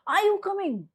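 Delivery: high, forceful demonstration question; high pitch demo. Shown as a demonstration. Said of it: "This is my high pitch sound".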